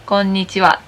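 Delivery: Konnichiwa said on one level pitch; flat Konnichiwa. Shown as a demonstration. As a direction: keep Konnichiwa straight with no up and down. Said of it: "It's really straight".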